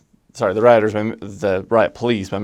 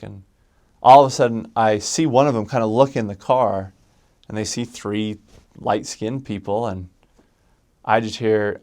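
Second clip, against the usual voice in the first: usual speaking voice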